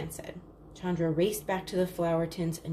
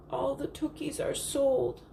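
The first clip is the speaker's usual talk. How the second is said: mild tearful sob, higher and slow; sobbing; 7 semitones higher than usual. Acted. Chandra tells father the lamps are sold, mild sob. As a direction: let the words sink in defeat, a touch of tears. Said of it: "she sobbed".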